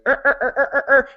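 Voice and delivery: high mock straining grunts, er er er; a brain grinding into gear, very high; wheels cranking. Brienne's wheels turning, high straining er noises. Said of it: "the wheels start a cranking".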